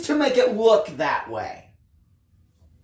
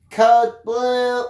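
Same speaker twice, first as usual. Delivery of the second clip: flat, louder robotic PA voice; robotic announcement; 4 semitones higher, 5 dB louder than usual. Acted. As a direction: call it out like a machine over a speaker. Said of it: "I heard a robotic voice call out".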